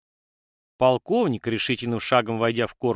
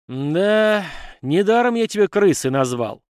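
contemptuous sighing remark, pitch raised; exasperated sigh; 8 semitones higher, 6 dB louder than usual. A narrator's reading. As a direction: draw out the first word, then scorn him. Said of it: "вздохнул полковник".

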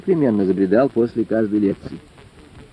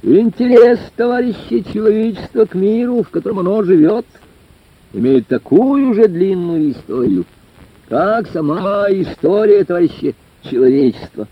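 orator's declamation; 8 semitones higher than usual. A narrator's reading. Lecturer opens his talk to the village club hall, declaiming. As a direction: declaim it grandly from the rostrum. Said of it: "трибунно бросил в зал".

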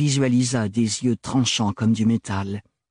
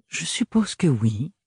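sugary murmur; 5 semitones higher than usual. Acted. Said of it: "susurra Archibald, doucereux".

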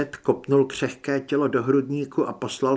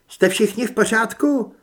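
high, loud anxious question; urgent concern; 9 semitones higher, 6 dB louder than usual. A narrator's reading. Running woman checks all are safe, urgent and loud. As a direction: call out to check on everyone. Checking on everyone after the shooting.

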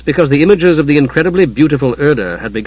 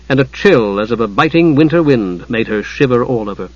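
ordinary delivery

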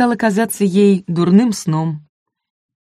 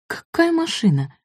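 much higher, startled question; surprised; 11 semitones higher, 4 dB quieter than usual. Acted. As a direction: blurt it out in sudden shock. Shocked by unexpected news.

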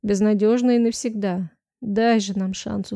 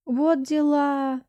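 slow, high amazed exclamation; drawn-out gasp of amazement; stunned; 5 semitones higher, much slower than usual. Acted. Miranda stunned by the story. (ошеломлённо)

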